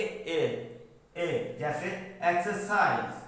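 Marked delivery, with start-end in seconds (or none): 0.0-1.6 ए as one isolated vowel; pronunciation demo. Shown as a demonstration. Teacher answering how to pronounce E, isolated ए. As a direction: sound out ए alone and evenly. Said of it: "इसको हम कैसे pronounce करेंगे".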